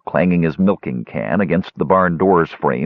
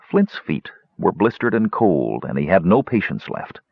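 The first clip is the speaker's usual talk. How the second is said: usual speaking voice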